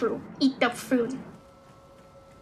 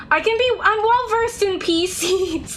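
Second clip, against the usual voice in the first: laughing; 6 semitones higher than usual. Real, not acted. Laughing at own joke.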